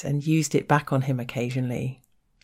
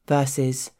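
normal talking voice

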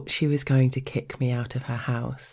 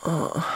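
moan; 3 semitones higher than usual. A narrator's reading. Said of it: "I moaned".

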